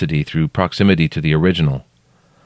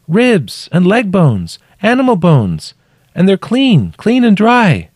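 high, loud listing, spaced out; calling up; 13 semitones higher, 5 dB louder, slower than usual. A narrator's reading. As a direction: call each item up from below. Trapped woman calling up.

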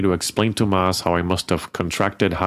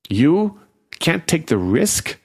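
incredulous outrage; 8 semitones higher, slower than usual. A narrator's reading. The dying fairy throwing Angela's excuse back at her, outraged. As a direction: fling the words back in disbelief.

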